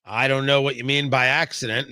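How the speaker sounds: usual speaking voice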